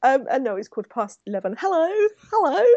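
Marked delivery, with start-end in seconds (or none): 1.6-1.9 bright high sing-song hello; a cheery high-pitched greeting; playful high hello. Acted. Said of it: "playful repeated greeting". 2.3-2.6 higher sing-song hello; even higher playful hello. Acted. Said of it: "playful repeated greeting".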